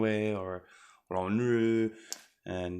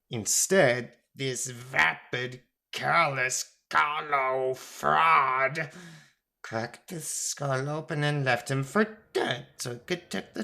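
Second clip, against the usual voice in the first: drawn-out insults in a higher character voice; Thoth, scornful accuser; 5 semitones higher, slower than usual. Acted. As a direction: lay out the crime with slow, indignant scorn.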